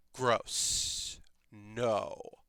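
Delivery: low, hushed, drawn-out "gross"; quiet mock disgust; imitated disgusted refusal. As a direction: refuse softly in deadpan distaste, dropping the pitch. Friend's character refusing to raise undead.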